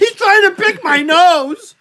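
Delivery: high and loud, half laughing; loud laughing protest. Real, not acted. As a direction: squeal it in protest.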